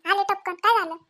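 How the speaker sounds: normal talking voice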